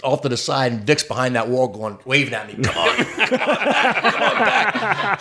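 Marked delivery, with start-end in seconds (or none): 2.6-5.2 high, drawn-out call from backstage; urgent beckoning. Retold or imitated. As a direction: coax him over, slow and pitched up. Acts man beckoning from behind wall.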